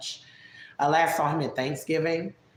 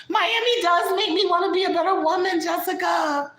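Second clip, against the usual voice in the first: very high, insistent put-on voice; high, protesting impression of a friend; Vegas; 12 semitones higher than usual. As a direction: argue back in a high-pitched caricature. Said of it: "These are things that she said".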